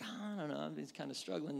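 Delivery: softly admitting a struggle; subdued reply. Acted. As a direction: answer low-key and hesitant. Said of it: "the first one you're like".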